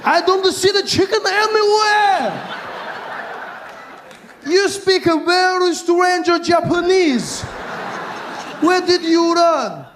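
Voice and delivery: put-on high, slow Japanese-accented shout; a high exaggerated Japanese accent; mock Japanese accent. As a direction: exclaim in a hammed-up Japanese accent. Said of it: "He was like".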